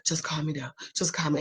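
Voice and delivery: whispered, low plea to be calmed; pleading whisper. Retold or imitated. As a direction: whisper it like you need calming. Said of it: "I'm like".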